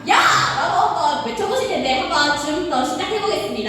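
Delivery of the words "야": short sharp yell; loud demo shout. Shown as a demonstration. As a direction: show them how loud to shout. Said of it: "크게 아까처럼".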